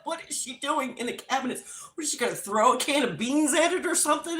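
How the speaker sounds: fast, voiced rant; racing, incredulous questioning; frantic bewilderment. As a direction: rattle it off in rising disbelief. Same thought, now frantic out loud.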